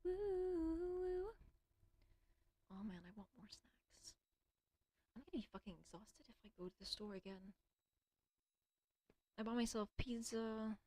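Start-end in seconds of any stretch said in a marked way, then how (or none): 0.0-1.3 playful hoot. Real, not acted. Playful hooting while alone. 2.7-7.5 breathy whispered complaint. Real, not acted. Whispering to herself.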